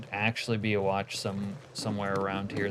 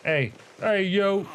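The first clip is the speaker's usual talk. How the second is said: higher, louder hey hey yo; hey yo call-out; 11 semitones higher, 6 dB louder, slower than usual. Pretend. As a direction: call out sharply, high and loud. Calling out in game.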